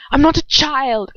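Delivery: said in the wrong character's voice; Narcissa voice by mistake. Acted. Draco's line said by mistake in the mother's voice. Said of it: "I forgot my Draco voice".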